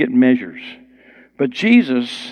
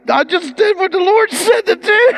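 far higher, exuberant; excited retold reply; 4 dB louder than usual. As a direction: toss it off with delighted energy. Preacher's own retold answer.

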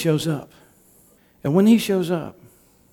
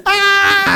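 high, loud cry of alarm; startled cry; 7 dB louder than usual. Retold or imitated. Peter cries out as the wind catches him off guard, a sudden high yell.

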